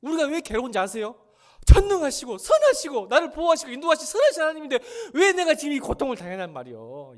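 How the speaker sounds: high, loud anguished sermon cry; full-force preaching. Acted. Preacher torn because he cannot deny God, full-force preaching. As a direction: cry out the torment to the congregation.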